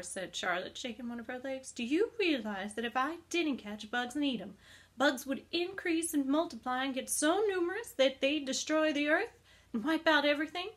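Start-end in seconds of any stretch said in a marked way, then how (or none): 1.7-10.7 building louder, higher spider warning; a hint of grand alarm; dramatic spider warning. Charlotte warns dramatically.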